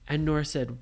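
plain reading voice

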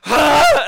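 short, high, loud "ah" burst; mock screech. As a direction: imitate a startled screech. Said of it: "still just screeching, basically".

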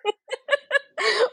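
high-pitched burst of laughter. Real, not acted. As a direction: let out a squealing laugh. Laughing at joke.

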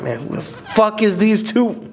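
high, drawn-out mock outrage; indignant disbelief. Guest recalling finding a band with their name, mock indignant. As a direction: re-enact baffled indignation, slow and high. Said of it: "I was like".

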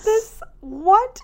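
high, loud squeal of surprise; stunned disbelief. Retold or imitated. Stunned reaction to home massage offer.

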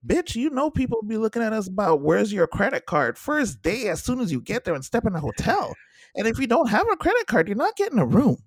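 high, loud, fast-building sassy scolding; mock lecture from a fed-up friend who has seen it all; sassy retold rant. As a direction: lay into her like a fed-up friend. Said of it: "I was like".

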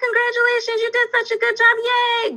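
put-on high, sing-song cheering for a toddler; excited praise. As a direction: celebrate like the biggest party. Praising child on potty.

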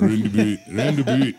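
loud, hammed-up Australian accent on Roundabout. Shown as a demonstration. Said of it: "sounds better saying it with an Australian accent".

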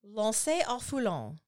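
slow, careful French "Lancy-au-Foulon"; non-native French attempt at "Lancy-au-Foulon"; French pronunciation. Shown as a demonstration. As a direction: say "Lancy-au-Foulon" slowly as lon-say-oh-foo-lon. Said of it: "Say it again in French".

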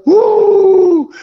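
loud, very high siren whoop; a piercing sustained vocal siren; imitated police siren. Second cop pulling them over, loud imitated siren.

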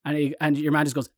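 normal talking voice

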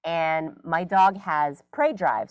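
ordinary talk, nothing marked

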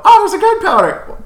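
put-on very high, loud excited kid squeal; twelve-year-old voice. Kid spotting a gunpowder barrel, twelve-year-old voice. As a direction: cry out like a thrilled kid. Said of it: "Why do I keep doing it? Twelve-year-old accent?".

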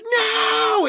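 high, loud wail of protest; dramatic no. Retold or imitated. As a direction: cry out no in panic. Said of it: "and she's like".

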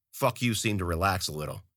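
plain reading voice

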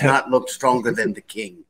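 lightly put-on Spanish accent; mock Spanish courtier with a slight accent. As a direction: do a half-hearted Spanish accent. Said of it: "That's my Spanish accent".

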